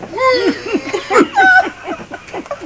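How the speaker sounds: high honking horn noise from the bull; bull horn blast. Retold or imitated. Storyteller blasts the bull's horn sound high.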